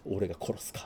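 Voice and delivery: soft whispered menace; whispered threat. Retold or imitated. Instructor Mozu threatening the team, in a whisper. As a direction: breathe it as a cold threat. Voicing Mozu's quiet threat.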